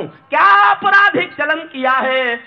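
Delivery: high, loud, slow declaimed question; drawn-out ringing challenge; impassioned verse. Acted. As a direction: shout the question out slowly.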